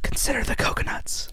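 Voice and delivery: breathy whispered lyric. Pretend. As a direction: whisper it like a secret.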